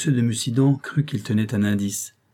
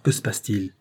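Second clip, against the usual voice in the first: ordinary delivery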